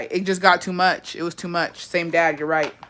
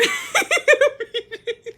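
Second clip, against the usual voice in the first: high-pitched burst of laughter. Real, not acted. Laughing at a viewer's comment.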